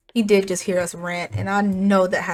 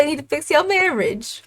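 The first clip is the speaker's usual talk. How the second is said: mock yelling; 5 semitones higher than usual. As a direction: throw it at dad higher, stretching out MARRIAGE. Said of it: "She is yelling at him".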